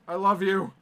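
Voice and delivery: dramatic farewell. Pretend. Mock farewell to lost keys.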